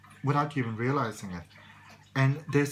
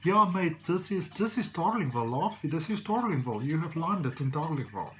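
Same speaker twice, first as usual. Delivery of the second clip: higher, lilting attempt at a Scottish accent; bad Scottish accent; 4 semitones higher than usual. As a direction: put on a terrible Scottish accent. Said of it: "I've got the worst Scottish accent in the world".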